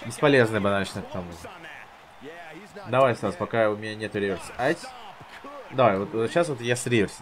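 ordinary delivery

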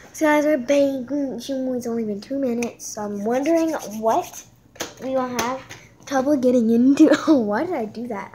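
loud, hyped-up yelling at the camera; loud excited rant; excited shouting. Real, not acted.

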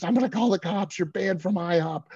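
high-pitched mimicked manager threat; mock screech, high and insistent; screaming. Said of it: "and she's screaming".

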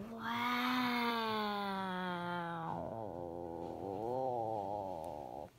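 low, eerie drawn-out wow; a ghostly moan in the dark; spooky voice. Acted.

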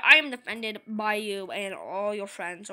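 ordinary talk, nothing marked

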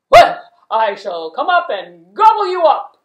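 very loud menacing troll; troll threat. Acted. Troll threatening to eat goat, very loud menacing troll. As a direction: boom the threat like a hungry troll.

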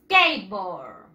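SKATEBOARD as two slow English syllables, "skeit-bord", falling low; English pronunciation model. Shown as a demonstration. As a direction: model SKATEBOARD for repeating, drawn out and dropping in pitch.

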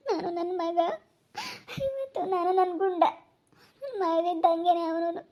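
crying while lamenting a lost mother; real, halting sobs between phrases; tearful grief. Real, not acted. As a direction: let each phrase break off into tears. Weeping over losing mother.

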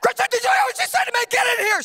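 high, loud indignant yell; husband shouting for his wife after the toddler insults him. Retold or imitated. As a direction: call her in, outraged. Yelling for wife in anger.

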